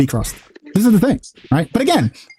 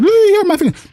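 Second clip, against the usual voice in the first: high mock whiny "really? my feelings"; mocking crybaby voice. Mocking the idea of a friend crying, high and whiny. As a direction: squeal it like a sulking crybaby. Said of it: "you like".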